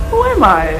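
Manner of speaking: high, loud mock outrage; mock dramatic. Higher louder repeat.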